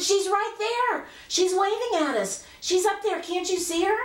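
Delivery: put-on very high, urgent little-girl voice; insistent child. Child showing her doubting mother the window, insistent.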